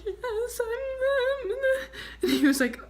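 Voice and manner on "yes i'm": high voice breaking into sobs; crying. Retold or imitated. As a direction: choke on the answer. Crying answer to officer.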